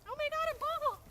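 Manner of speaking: high, shrill excited squeal; squealing surprise. Acted. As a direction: squeal like a startled kid. Silly voice exclaims.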